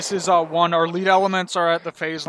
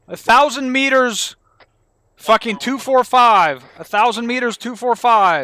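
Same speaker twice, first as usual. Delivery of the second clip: loud, slow, higher callout over the radio; urgent shout; 7 semitones higher, 9 dB louder, slower than usual. Real, not acted. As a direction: yell the range and bearing. Urgently calling out enemy position.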